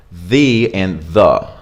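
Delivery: "The" first as thee, then "the" as thuh; slow demo of "The and the", thee then thuh; two ways to say the. Shown as a demonstration. Teacher contrasting "The and the" for learners, slow and clear. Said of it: "two possible ways to pronounce this word".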